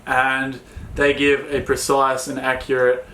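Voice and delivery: normal talking voice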